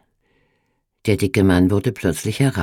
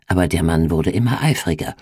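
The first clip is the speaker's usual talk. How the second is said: ordinary delivery, nothing marked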